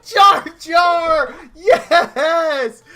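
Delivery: high, loud celebratory yell; hammed-up euphoric cheer; high-as-a-kite elation. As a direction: holler the name in stoned delight. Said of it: "He's high as a kite".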